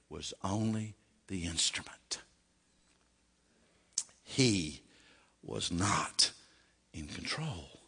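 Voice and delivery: whispered, voice dropping away. Real, not acted. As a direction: lean in and whisper the point. Hushed point after the story.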